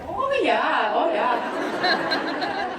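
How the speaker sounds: high, playful Minnesota "oh, yeah"; Minnesota accent. Shown as a demonstration. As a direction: lay on the Minnesota accent thick. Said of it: "falling back into the Minnesota accent".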